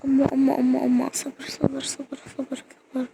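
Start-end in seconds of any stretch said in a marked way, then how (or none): 1.1-3.1 whispered calming. Real, not acted. Calming herself down.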